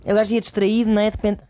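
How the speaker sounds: ordinary talk, nothing marked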